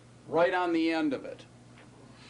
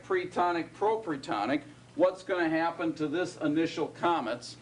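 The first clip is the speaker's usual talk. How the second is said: ordinary talk, nothing marked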